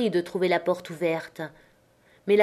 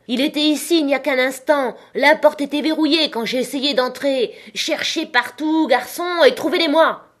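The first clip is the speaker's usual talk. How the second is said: high, agitated shouting; building to a loud command; furious urgent orders; 7 semitones higher, 3 dB louder than usual. A narrator's reading. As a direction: drive the men on to search. Blind man furiously orders a search.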